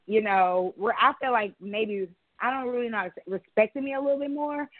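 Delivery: ordinary talk, nothing marked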